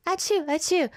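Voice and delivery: high playful fake sneezes; two hammed-up little sneezes. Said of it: "now I'm just like".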